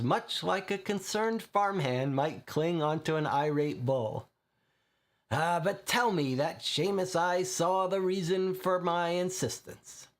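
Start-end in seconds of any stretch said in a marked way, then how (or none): 5.3-10.1 Irish Gamp voice. Acted.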